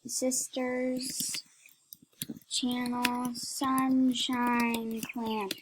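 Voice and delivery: word-by-word dictation. Real, not acted. Dictating a typed message word by word.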